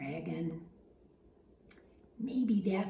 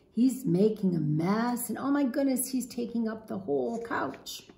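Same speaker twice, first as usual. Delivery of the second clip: plain reading voice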